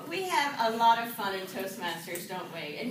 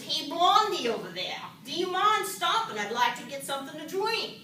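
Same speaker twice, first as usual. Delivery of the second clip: louder, big New York guy; New York accent; 2 semitones higher, 5 dB louder than usual. Acted. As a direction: cut in as an impatient New Yorker. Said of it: "this New York guy".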